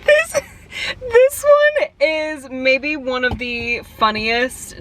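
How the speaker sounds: giggling through the start of the sentence; laughing. Real, not acted. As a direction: try to talk while cracking up.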